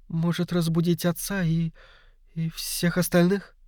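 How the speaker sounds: high, soft, hesitant voice; timid suggestion. Acted. Youngster asks Poirot about waking everyone, timid and soft. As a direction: suggest it tentatively, faltering midway.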